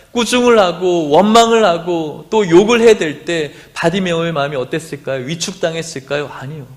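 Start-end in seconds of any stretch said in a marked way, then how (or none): none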